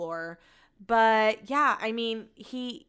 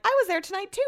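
high excited commenter; 12 semitones higher, faster than usual. Retold or imitated. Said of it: "was like".

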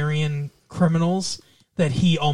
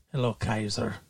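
low, soft imitation of an Irish accent; lightly imitated Irish accent; 6 semitones lower, 7 dB quieter than usual. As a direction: try a quiet Irish-accented greeting, pitched low. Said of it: "say it in his Irish accent".

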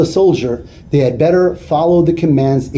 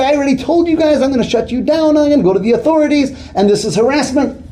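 angry tirade; 8 semitones higher, faster than usual. Retold or imitated. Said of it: "he finished his tirade".

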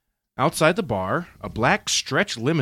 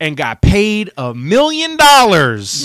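incredulous punchline; 5 semitones higher, 11 dB louder, slower than usual. Real, not acted. Punchline about the story's ending.

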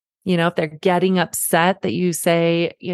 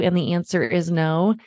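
normal talking voice

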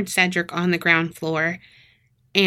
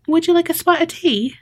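lightly British, higher-pitched hostess offer; higher voice, hint of British accent; 9 semitones higher, faster than usual. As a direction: offer it with a touch of mock British. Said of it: "I mean, she wasn't British".

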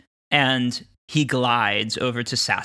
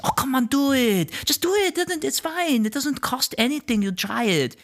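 high squeaky wheedling voice, much higher; high coaxing girl; 13 semitones higher than usual. Retold or imitated. She urges him to try it, high and wheedling.

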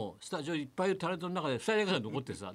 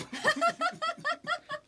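shrieking laugh. Real, not acted. Host laughs at the nickname.

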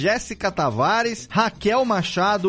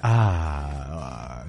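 velvety voice. Acted. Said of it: "através dessa voz aveludada".

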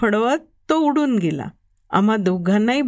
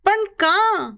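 sharp high cry of protest; can't hold it in, shout at him; 8 semitones higher, much slower than usual. A narrator's reading. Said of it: "आजोबांवर ओरडलो". (आजोबांवर उंच आवाजात ओरडणे)

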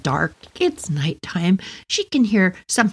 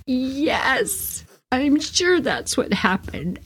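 sarcastic mom; 6 semitones higher, much slower than usual. Retold or imitated. Mom's dry reply.

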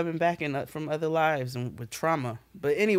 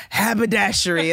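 teasing father; 5 semitones higher, 6 dB louder than usual. Retold or imitated. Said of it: "he'd go".

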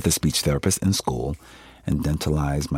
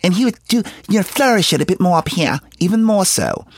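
much higher, louder voice, placed right up high; higher pitch; 5 dB louder than usual. Shown as a demonstration. Actor showing interviewer how Rustin's voice flourished, high-pitched. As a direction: push the pitch well up and project. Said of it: "it was even higher pitch".

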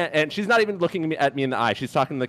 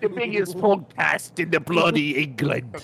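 mock British accent; 3 semitones higher than usual. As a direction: lay on a silly British accent.

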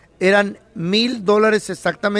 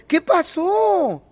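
higher, slow, lightly voiced suspicious lady; mock homeowner lady; 8 semitones higher, much slower than usual. Homeowner lady arriving to check on workers, lightly voiced. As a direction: ask it like the nosy lady of the house.

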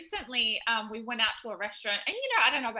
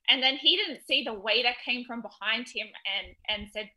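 ordinary talk

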